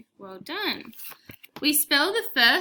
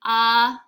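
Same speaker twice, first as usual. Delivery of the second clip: word said out loud and firm for the class; clear model word; 8 dB louder than usual. Shown as a demonstration. Teacher modelling the word for children, loud and clear. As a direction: announce the word clearly to pupils. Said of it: "the word art. Ready?".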